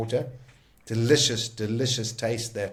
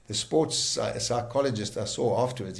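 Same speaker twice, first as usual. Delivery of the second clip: normal talking voice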